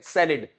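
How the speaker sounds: सैलेड said SAL-id with falling end; correct English pronunciation. Shown as a demonstration. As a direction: drill सैलेड, first syllable stressed. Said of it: "इसको सैलेड बोला जाता है". (सैलेड का सही उच्चारण, आख़िर में छोटा 'इड')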